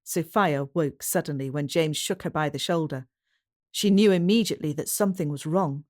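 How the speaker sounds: plain reading voice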